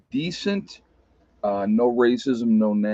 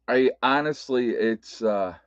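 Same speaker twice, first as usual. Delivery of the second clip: ordinary delivery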